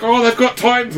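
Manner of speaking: high, excited gush about Titans; excited fan. Retold or imitated. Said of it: "was like".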